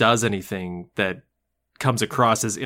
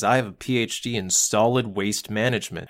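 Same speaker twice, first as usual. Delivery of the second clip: lower, understated deadpan delivery; straightest deadpan; 5 semitones lower, 4 dB quieter than usual. Retold or imitated. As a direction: state the job title with total seriousness. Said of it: "gives it with the straightest deadpan".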